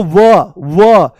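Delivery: "व, व" said loud and very slow, letting the oo glide ring; demonstrated va sound. Shown as a demonstration. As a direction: sound out "व, व" deliberately for the class to copy. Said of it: "जैसे ही व बोलते हो".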